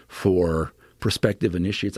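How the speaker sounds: normal talking voice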